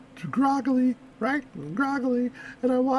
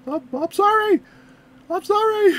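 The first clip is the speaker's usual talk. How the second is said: mock calling after someone, higher pitched; raised-voice retold sorry; apologetic call-out; 6 semitones higher, 3 dB louder than usual. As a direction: imitate a pleading apology called after a driver. Calls apology after fleeing driver.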